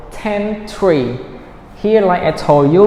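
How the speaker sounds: ordinary talk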